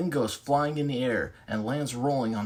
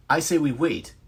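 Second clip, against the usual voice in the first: raised arguing voice for waiting; 5 semitones higher, 7 dB louder than usual. Acted. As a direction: push back hard, insist on waiting.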